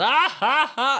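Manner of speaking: high loud honking laugh; mock braying laugh. Mocking the constant laugher, high braying. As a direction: honk out the laugh. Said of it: "someone is just going".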